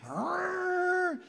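high, strained pirate-like growl of a beast; animal roar. Pretend. Driver losing it in traffic, mock animal roar. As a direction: let a silly animal yell burst out. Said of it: "And you're like".

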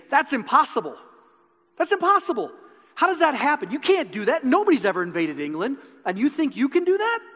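high, loud scornful shouting; mocking shout. Nobleman shouting Wallace down, high scornful yell. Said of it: "this guy is shouting him down".

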